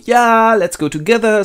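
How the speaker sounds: high, loud mock enthusiasm; eager group cheer. The fellowship agrees to join Frodo, high cheer. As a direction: chime in like a whole eager gang. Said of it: "And all go".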